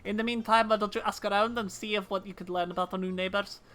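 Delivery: steady Scottish officer's voice, lightly hammed; fake Scottish accent. Officer discussing kobold diplomacy with a recruit, fake Scottish accent. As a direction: play a Scottish officer wrapping up a mission. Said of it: "fake Scottish accents".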